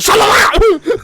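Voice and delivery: loud, high imitation of an irate cousin; mock angry outburst. Retelling Richie's cousin snapping back, mock-furious yell. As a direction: yell it as a hammed-up retort.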